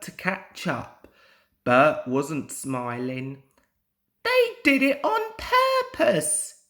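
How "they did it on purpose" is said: very high, louder cross engine voice; cross snort. A narrator's reading. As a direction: snort it out in annoyance. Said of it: "he snorted crossly".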